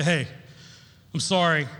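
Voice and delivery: normal talking voice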